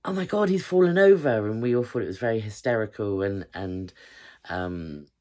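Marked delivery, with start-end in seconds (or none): 0.1-1.5 high, loud imitated gasp of disbelief; Laura in the back seat, shrieking with delight; mock shocked shriek. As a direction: play Laura spotting the guard fall, thrilled. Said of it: "she was like".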